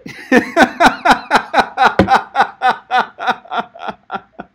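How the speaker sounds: long, loud rhythmic laugh; big belly laugh. Real, not acted. Coach reacting to a student's outrageous story, big laugh. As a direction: laugh uproariously at a performance.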